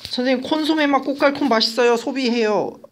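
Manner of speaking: high-pitched imitation of a pupil; middle-school student. Student telling the teacher a memory trick, high mimicked voice. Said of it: "이렇게 외우신 분이 있어요".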